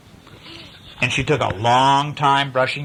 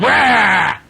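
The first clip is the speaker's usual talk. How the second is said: angry roar; 9 semitones higher, 7 dB louder than usual. Acted. Angry boy growls at sister.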